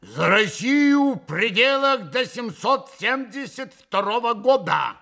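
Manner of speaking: loud, high, resounding toast; зычным голосом. A narrator's reading. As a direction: proclaim it formally with full voice. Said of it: "зычным голосом проговорил". (зычно, торжественно)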